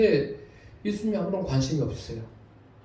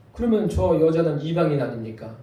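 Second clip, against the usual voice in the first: ordinary talk, nothing marked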